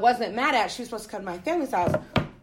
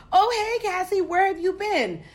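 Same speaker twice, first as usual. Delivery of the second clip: high sing-song mock cheer, much higher; mock sweet greeting; 9 semitones higher than usual. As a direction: imitate them all sugary and fake. Mocking the giggling girls' greeting.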